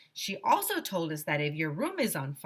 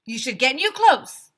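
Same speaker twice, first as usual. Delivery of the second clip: high, loud, proud answer; eager kid answer; 7 semitones higher, 10 dB louder than usual. A narrator's reading. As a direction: blurt it out proudly. Kid's eager wrong answer.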